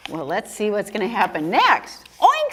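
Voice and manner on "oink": high-pitched storybook oink; pig's oink. A narrator's reading. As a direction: squeal out a high little oink. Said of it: "said the pig".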